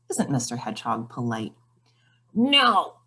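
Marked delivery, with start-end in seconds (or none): 2.3-3.0 grumpy gnome refusal. Acted.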